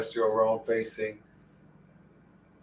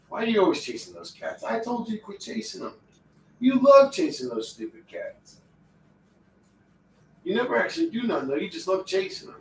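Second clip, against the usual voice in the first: higher, teasing voice for the dog; talking to the dog; 6 semitones higher than usual. Real, not acted. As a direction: scold the dog playfully, fast. Scolding dog in pet voice.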